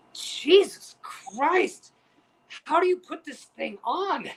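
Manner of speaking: high, drawn-out strained gasp of words; struggling to breathe. Retold or imitated. Friend stuck in a tank top, struggling and strained. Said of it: "he was so struggling".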